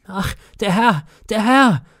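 high, loud scream of shock. A narrator's reading. Maid finding the dead master, high loud scream. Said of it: "das Dienstmädchen schrie".

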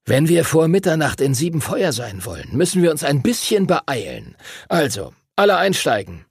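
drängender Ausbilder, deutlich höhere Stimme; drängender Master Duncan. A narrator's reading. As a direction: die Schüler zur Eile antreiben. (Ausbilder kommandiert zum Aufbruch)

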